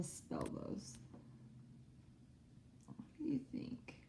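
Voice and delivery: soft whispered prompt; teacher whispering to get students thinking. Real, not acted. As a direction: whisper the question to the class.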